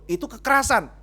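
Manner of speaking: loud, high-pitched accusing question; acted interrogation. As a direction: fire the accusation at full volume. Acted pastor interrogates the husband.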